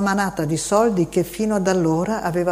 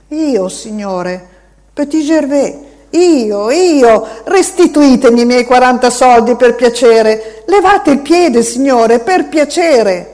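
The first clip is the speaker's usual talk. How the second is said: put-on high, loud begging little boy; pleading child; 6 semitones higher, 10 dB louder than usual. A narrator's reading. Boy begging the man to lift his foot, high pleading.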